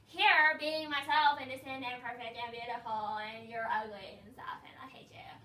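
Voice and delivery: mock a dramatic girl playing victim; hammed-up teen wail fading to a hiss; whiny mock girl. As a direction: overblown whiny diva, whimpering then hushed. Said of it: "then she'd be like".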